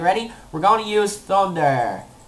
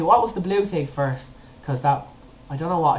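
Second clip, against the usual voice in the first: usual speaking voice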